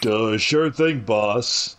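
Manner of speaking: slow, dopey henchman voice; lightly voiced big dumb goon. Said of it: "kinda doing his big dumb guy voice".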